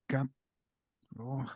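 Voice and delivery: ordinary delivery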